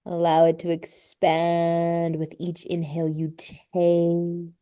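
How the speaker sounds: slow, drawn-out yoga teacher voice; guided yoga relaxation. Real, not acted. Yoga teacher settling a class into child's pose, calm guided relaxation. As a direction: ease them into rest with long, lingering words.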